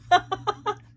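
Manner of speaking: high, loud burst of laughter; real high-pitched giggle; amused laugh. Real, not acted. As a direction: laugh out high at your own joke. Laughs after joking about accent.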